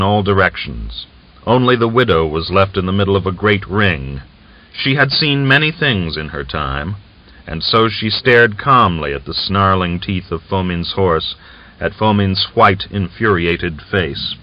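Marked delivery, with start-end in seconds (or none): none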